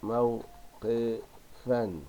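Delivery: "موقفا" in slow, widely spaced syllables; very slow broken-up "موقفا". Shown as a demonstration. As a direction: dictate "موقفا" piece by piece, pausing after each. Dictates word while writing.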